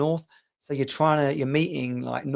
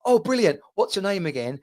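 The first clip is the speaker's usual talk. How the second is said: breezy ask; 6 semitones higher than usual. Shown as a demonstration. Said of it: "if you say to someone".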